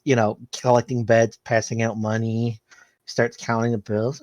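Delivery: usual speaking voice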